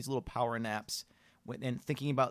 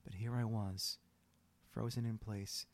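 somber story reading; 4 dB quieter than usual. Acted. Reads out sad story.

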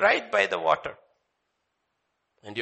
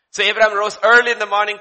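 louder, proclaiming read; loud scripture reading; 6 semitones higher, 10 dB louder, faster than usual. Real, not acted. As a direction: declare the verse.

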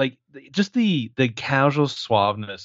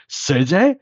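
high, rising mock Russian pleading; Bogdan voice; 6 semitones higher than usual. Retelling the van scene, doing Bogdan's confused questions. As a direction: ham up a confused goon asking his friend. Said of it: "Bogdan character".

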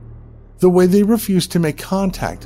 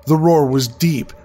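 ordinary delivery

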